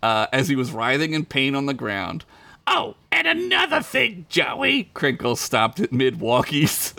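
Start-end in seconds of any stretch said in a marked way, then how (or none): 2.7-4.9 high squeaky gangster voice; menacing Crinkles. Acted. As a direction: lean in like a tiny crime boss. Crinkles calls back.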